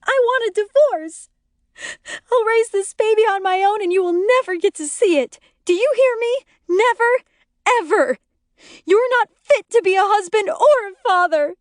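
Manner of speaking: very high, loud furious screech. A narrator's reading. Wife screeching divorce at her husband. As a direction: screech it at him, all control gone. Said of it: "Lori screeches".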